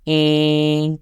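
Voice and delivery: "E." held level and steady; flat first tone. Shown as a demonstration. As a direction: keep "E." on one flat pitch. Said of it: "a Chinese word with the first vowel".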